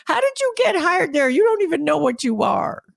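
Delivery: lightly acted scornful exec; incredulous jab. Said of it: "And he says".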